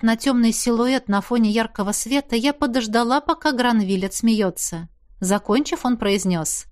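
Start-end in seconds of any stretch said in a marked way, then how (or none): none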